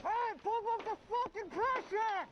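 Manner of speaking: very high strained yelling; firefighter shouting through mask. Real, not acted. Calling for water pressure.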